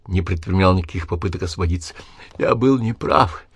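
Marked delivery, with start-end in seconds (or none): 2.4-3.4 slow, raised wheeze; dying man wheezing a confession. A narrator's reading. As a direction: rasp it out with failing breath. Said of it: "хрипел он".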